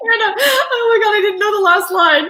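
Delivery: high, loud, real laughter through words; real cracking up, voice shooting high; laughing in disbelief. Real, not acted. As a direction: gasp it out through helpless laughter. Host cracks up at last line.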